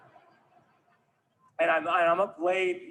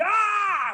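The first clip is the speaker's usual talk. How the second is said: very high, sudden yell; acted scream; 4 dB louder than usual. Comedian jumping through a window, high comic scream. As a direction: scream as if leaping through glass. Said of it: "and I screamed".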